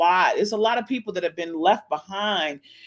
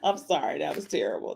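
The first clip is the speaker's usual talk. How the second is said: ordinary talk, nothing marked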